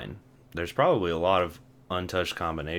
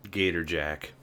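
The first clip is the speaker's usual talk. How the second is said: normal talking voice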